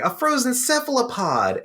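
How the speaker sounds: high, cartoonish sponge voice; SpongeBob voice. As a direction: squeak it out like SpongeBob. SpongeBob's line retold, high voice.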